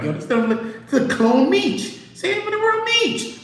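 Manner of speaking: high-pitched heckler imitation; mocking crowd. Everybody cooking Meech as a clone, high mocking voice. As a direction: jump up high and roast him. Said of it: "everybody was cooking him like".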